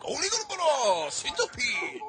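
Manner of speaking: high, slow, stretched-out announcer call; mock Japanese announcer. Describing the bug-fight site to friends, high slow mock announcer. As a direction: ham up a fight announcer's intro. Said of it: "a Japanese announcement that's like".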